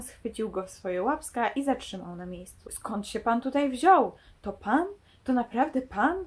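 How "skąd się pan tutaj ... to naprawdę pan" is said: usual speaking voice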